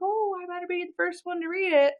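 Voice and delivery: high-pitched, delighted gush; excited grandma. Retold or imitated. Grandma hearing about the book for the first time, excited. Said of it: "my grandma being really excited about it".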